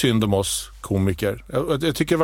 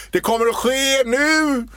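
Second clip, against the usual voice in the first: slow, higher, grand declaration; hammed-up dramatic announcement; mock solemn proclamation; 9 semitones higher than usual. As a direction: proclaim it like a historic moment. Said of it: "du gjorde en stor sån här".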